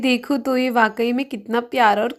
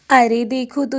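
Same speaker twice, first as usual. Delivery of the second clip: plain reading voice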